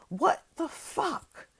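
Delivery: slow, high, drawn-out disbelief; stunned outrage. Real, not acted. Outraged vlogger reacts in slow disbelief to the news. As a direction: stretch it out, aghast and high.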